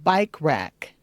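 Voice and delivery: Bike with a long I; careful bike. Shown as a demonstration. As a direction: say Bike rack with a clear long I. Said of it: "Be careful how you say bike".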